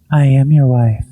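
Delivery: possessed voice, pointed and staring; eerily seductive. Retold or imitated. Possessed wife looking at the camera, eerily seductive. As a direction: imitate her changed, knowing demeanor. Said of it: "you've never looked at me that seductively".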